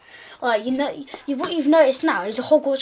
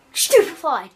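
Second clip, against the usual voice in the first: sharp, loud and higher spell cast; shouted spell; 6 semitones higher, 7 dB louder than usual. Acted. Game master voicing an attacker casting at Albus, loud shout. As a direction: fire the spell off with a yell. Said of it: "And then he goes".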